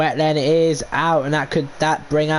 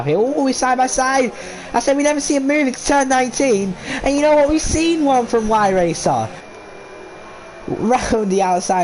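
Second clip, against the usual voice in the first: excited commentary; 12 semitones higher than usual. Real, not acted. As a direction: shout the battle as it happens. Side by side battle called live.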